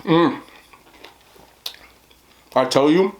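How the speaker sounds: talking through a mouthful of chicken; mouth full. Real, not acted. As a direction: say it while chewing. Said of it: "I don't like to talk my mouth full".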